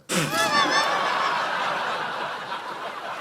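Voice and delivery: loud high-pitched laughing shriek. Real, not acted. Shrieking laugh at a wrong answer.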